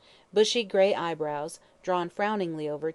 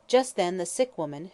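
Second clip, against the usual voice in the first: ordinary delivery, nothing marked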